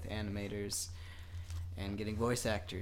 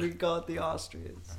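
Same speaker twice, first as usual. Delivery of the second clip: higher-pitched hammy Austrian; mock Austrian accent; 7 semitones higher than usual. Pitching the Austrian cartoon, higher mock Austrian accent. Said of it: "just everyone has that accent".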